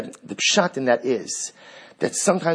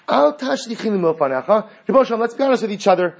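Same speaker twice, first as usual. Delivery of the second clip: rising fervor; 7 semitones higher, 3 dB louder than usual. Acted. Preacher voicing David's demand.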